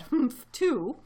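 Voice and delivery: put-on young kangaroo. A narrator's reading. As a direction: huff like a copycat joey. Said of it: "young kangaroo in her pouch said".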